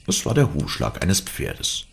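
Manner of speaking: usual speaking voice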